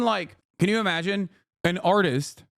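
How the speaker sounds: ordinary delivery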